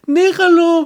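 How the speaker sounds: high-pitched teacher begging. Acted. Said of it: "La maestra".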